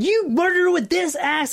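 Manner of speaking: high-pitched, theatrical accusation; menacing accusation. Retold or imitated. Lawyer shaking the axe at defendant, mock menacing. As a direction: accuse like a lawyer brandishing the weapon. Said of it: "like, shaking it".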